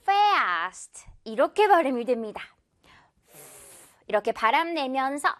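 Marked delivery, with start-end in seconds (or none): 0.0-1.1 "Fast" begun with air blown through teeth on lip; English F sound. Shown as a demonstration. Teacher models Fast for the class, F clearly blown. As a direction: bite the lip and blow the F of "Fast". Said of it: "F 발음 어떻게 발음한다".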